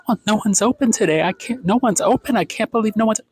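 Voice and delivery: high, whiny entitled-customer voice; mock her complaint in a high whine. Vlogger mocking a fussy customer, high and whiny.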